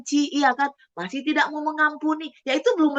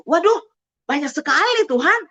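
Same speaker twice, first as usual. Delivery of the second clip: mock astonishment; 4 semitones higher, 4 dB louder than usual. Reacting to seventy times seven.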